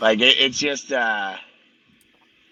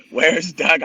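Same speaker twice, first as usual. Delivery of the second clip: louder repeat; 6 semitones higher, 7 dB louder than usual. Retold or imitated. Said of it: "She goes".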